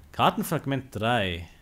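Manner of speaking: ordinary talk, nothing marked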